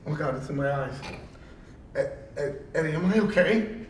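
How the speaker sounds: soft, faltering mock worry; imitating worried partner. Bleeding partner asks Eddie for reassurance, mock-worried. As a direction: stammer it quietly, lightly mimicking a worried rookie. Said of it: "He goes".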